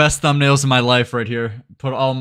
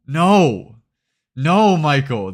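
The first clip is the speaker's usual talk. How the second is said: drawn-out disapproving no, slightly raised; mock dismay; 4 dB louder, much slower than usual. Streamer objects to Michael's response, mock dismay. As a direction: plead with him in exaggerated dismay.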